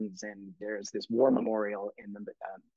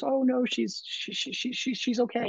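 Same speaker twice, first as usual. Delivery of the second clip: high, light voice for the dog owner; woman apologizing for her dog, high-pitched; apologetic woman; 13 semitones higher than usual. Retold or imitated. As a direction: play the polite Australian lady, pitched up. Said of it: "and she goes".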